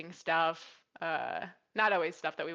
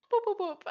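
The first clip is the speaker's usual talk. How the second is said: playful whoop; 3 dB louder than usual. Retold or imitated. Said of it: "I was going".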